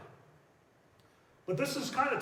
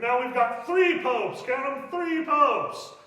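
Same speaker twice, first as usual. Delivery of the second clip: louder, slow and higher, hammering the count; emphatic disbelief; 5 semitones higher, 9 dB louder, slower than usual. Real, not acted. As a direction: make the absurdity land, slowly. Lecturer stresses absurd three popes.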